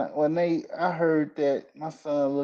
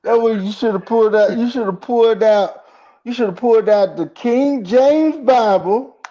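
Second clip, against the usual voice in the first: loud, high, fired-up telling-off; excited shout; 8 semitones higher, 9 dB louder than usual. Real, not acted. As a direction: push it out loud and high, laughing at the idea. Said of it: "telling him what to do".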